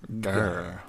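soft unvoiced growl; whispered growl. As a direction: breathe a playful grr.

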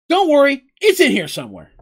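loud, high opening that settles in pitch; called-out reassurance. A narrator's reading. Mugen digging through his bag, calling out loudly. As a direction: call it out while busy searching.

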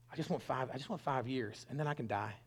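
subdued sorrow. Retold or imitated. Young man with kidney failure confiding, retold with subdued sorrow. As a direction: let it drop soft and heavy.

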